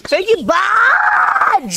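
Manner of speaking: long, high, stretched-out shout of thanks; drawn-out shout. Pretend. Host calling out thanks to Buzz, slow drawn-out yell. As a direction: hollers the name out slowly for the chat.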